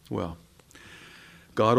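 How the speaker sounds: usual speaking voice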